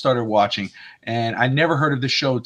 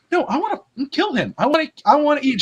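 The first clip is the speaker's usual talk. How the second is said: high, whiny-petulant vampire complaint; hurried, high-pitched insistence; mock protest; 10 semitones higher than usual. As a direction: imitate someone refusing to be calmed. Vampire protesting to his companion.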